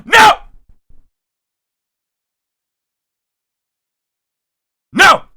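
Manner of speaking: loud exasperated no; shouting. Real, not acted. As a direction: cut it off with a yell. Cuts off the spam pitch.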